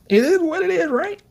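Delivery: high-pitched mocking sing-song; excited mock. Real, not acted. Mocking Sukuna's shrug, high sing-song taunt. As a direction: toss it off like a taunt.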